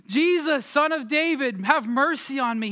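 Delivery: imitated plea called out high; crying out. Blind beggar calling to Jesus as he passes, crying out. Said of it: "he just starts crying out".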